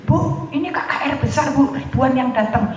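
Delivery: a hint of an eager event organiser. Imitates KKR organiser inviting her.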